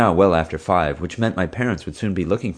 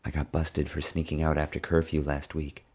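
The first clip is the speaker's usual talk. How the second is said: softer, hushed confession; keeping voice low; 7 dB quieter than usual. Acted. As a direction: drop the voice so nobody hears. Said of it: "now keeping her voice low".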